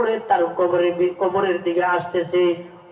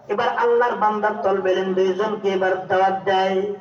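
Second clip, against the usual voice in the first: ordinary talk, nothing marked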